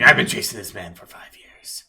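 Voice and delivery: loud, rough detective voice; intense, over-acted detective; driven detective. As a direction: throw your long hunt at a rival. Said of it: "the detective's like".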